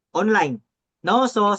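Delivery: usual speaking voice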